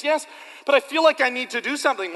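eager believer. Pretend. Pastor voices eager believer.

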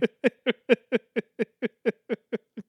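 high, unhinged clown cackle; maniacal cackle. Acted. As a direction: cackle like a gleeful prankster. Joker-style laugh after prank.

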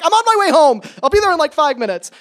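high, loud acted promise; hammed-up rushing-to-the-rescue voice, easing off at the end; urgent reassurance. Acted urgent promise to panicked friend.

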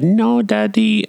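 put-on drawn-out, high little-child voice; Jackson. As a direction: imitate a child refusing, high and slow. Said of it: "Jackson came up and I said".

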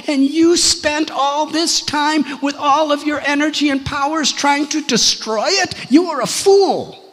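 loud, high-pitched tirade; angry scolding. Acted. Guru berates Indra for attacking Vrindavan, angry scolding. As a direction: berate him at full voice in one mounting rebuke.

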